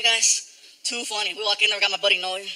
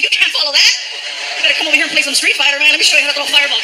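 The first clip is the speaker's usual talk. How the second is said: Frankie; 3 semitones higher, 6 dB louder, faster than usual. Retold or imitated. Teasing Frankie after the show.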